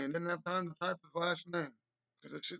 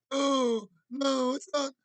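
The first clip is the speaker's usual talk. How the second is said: much higher, louder, outraged gasp; mock outraged denial; 8 semitones higher, 7 dB louder, slower than usual. Streamer showing the guest's expected reaction, hammed-up outrage. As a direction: act out the shocked denial he expects. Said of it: "the reaction should be".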